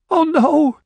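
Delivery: high, loud wail through tears; weeping cry. A narrator's reading. June wails over her dying husband, weeping. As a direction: cry out in grief. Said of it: "June said, weeping".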